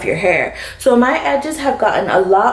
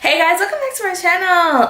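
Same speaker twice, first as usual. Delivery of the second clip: annoying intro greeting; 10 semitones higher than usual. Real, not acted. Vlogger opening the video for viewers, annoyingly high and sing-song. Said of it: "That was so annoying".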